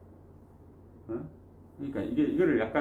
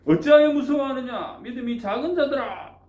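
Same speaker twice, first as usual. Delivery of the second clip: rebuke; 10 semitones higher, 5 dB louder than usual. Acted. Jesus rebuking fearful disciples.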